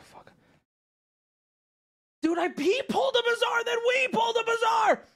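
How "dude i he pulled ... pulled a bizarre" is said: high, squealing cry on one held pitch; real giddy disbelief; incredulous excitement. Real, not acted. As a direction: blurt it out high in amazement. Excited disbelief at both pulls.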